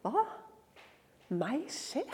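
very soft, barely voiced questions; hushed disbelief. Mary reacting to the angel's news, hushed. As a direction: ask it under your breath.

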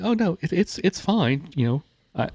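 imitating his own overly polite reply; high polite reassurance. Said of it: "And I'm like".

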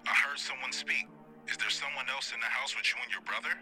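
operator voice. Acted. Operator on phone to Lana.

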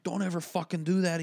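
retold angry boss; swearing boss. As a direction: snap the warning at the worker. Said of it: "He starts swearing at me".

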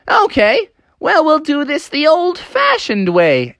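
high, odd character voice; weasel janitor. Acted. Weasel janitor questions the helpers and the trapped frog, high-voiced. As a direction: play a weasel janitor sizing things up. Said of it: "the janitor was like".

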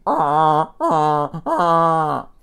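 three loud, high honks; complaining goose honks. A narrator's reading. Goose grabbed by Joey complains, loud honking. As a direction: honk in protest like a goose. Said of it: "the goose was complaining".

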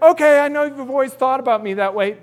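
carefree David. Acted. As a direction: let the insult roll off.

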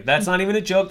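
normal talking voice

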